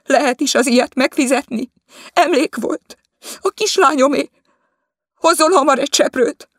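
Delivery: high, loud furious shouting; furious outburst. Acted. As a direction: rage at the maid over the keepsake. Mistress rages over broken mirror. (dühös, magas kiabálás)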